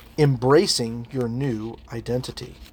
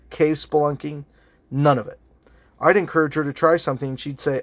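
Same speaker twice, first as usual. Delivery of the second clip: usual speaking voice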